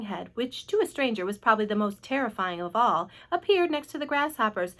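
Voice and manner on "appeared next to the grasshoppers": plain reading voice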